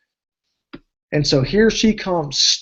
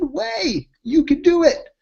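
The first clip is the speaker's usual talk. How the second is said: much higher playful comeback; answering a fireman's no way, pitched high; excited insistence; 11 semitones higher, slower than usual. Retold or imitated. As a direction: bounce back with eager conviction.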